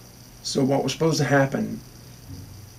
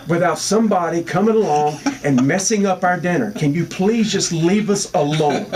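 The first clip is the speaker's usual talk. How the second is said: louder, higher exasperated telling-off; higher, louder fed-up rant, slowing at the end; fed-up outburst; 5 semitones higher, 3 dB louder than usual. Retold or imitated. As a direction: snap at her to leave you alone. Staged rude outburst at old lady.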